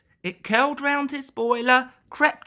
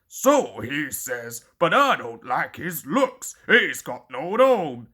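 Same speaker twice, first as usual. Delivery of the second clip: dark; 6 semitones lower than usual. A narrator's reading. Gordon darkly distrusts the domeless engine, low. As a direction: sneer with suspicion. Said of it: "said Gordon darkly".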